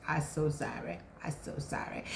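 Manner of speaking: low, soft, dropping almost to a whisper; hushed apology. Real, not acted. Vlogger apologizing to viewers for being away, hushed.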